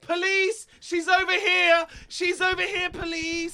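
high shrill outraged shouting. Acted. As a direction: scream it out, scandalised. Stacy shouting at drugs.

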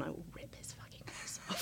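whispered joke threat, breath only; menacing whisper. Acted. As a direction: hiss it like a mock vow of revenge. Joking threat about sandwich man.

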